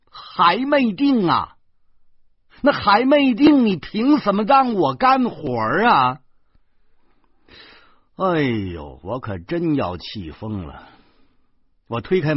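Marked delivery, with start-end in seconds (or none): 0.1-6.2 high, loud indignant yelling; frantic outrage. A narrator's reading. Job-seeker learning nothing is settled, loudly outraged. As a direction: explode at being led on, pitched way up. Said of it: "她真急了".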